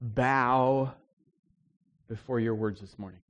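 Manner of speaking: soft, hushed pulpit prayer; quiet prayer. Acted. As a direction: pray gently to God before preaching. Pastor prays before the sermon.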